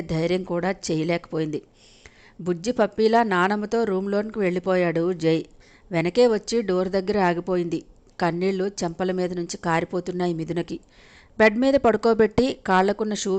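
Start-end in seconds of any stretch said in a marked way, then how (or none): none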